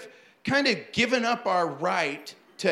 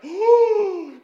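shocked gasp; 12 semitones higher, 8 dB louder than usual. Retold or imitated. Shocked at forgotten announcement.

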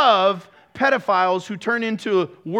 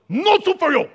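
a hint of the stern soup Nazi, higher; mock soup Nazi; 9 semitones higher, 4 dB louder than usual. Pastor mimics God as the soup Nazi turning someone away, mock stern and higher. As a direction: bark the refusal like a stingy cook, lightly voiced. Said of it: "Allah goes".

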